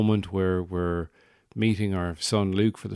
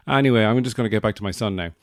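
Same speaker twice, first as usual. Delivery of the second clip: quick huffy aside; 2 semitones higher, 6 dB louder, much faster than usual. Retold or imitated.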